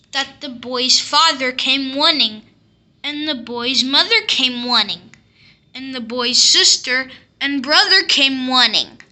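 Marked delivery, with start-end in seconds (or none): none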